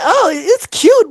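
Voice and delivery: put-on high, drawn-out girlish cooing; imitated girl. Girl judging his grill, imitated in a high, slow coo. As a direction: stretch it out high, mimicking her. Imitating the girl's polite reaction.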